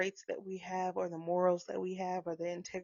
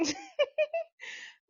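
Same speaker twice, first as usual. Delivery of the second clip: high burst of laughter. Real, not acted. Laughs mid acted quote.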